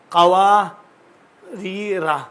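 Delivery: कवारीरा said very slowly, each syllable stretched; slow spelled-out word. Shown as a demonstration. Teacher showing students the written word, very slow. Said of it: "इस तरह लिखा गया है".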